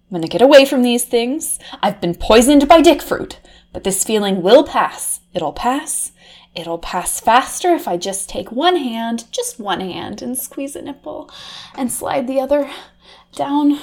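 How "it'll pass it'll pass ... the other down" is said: breathy aroused murmur. Acted. Aroused, drifting into fantasy.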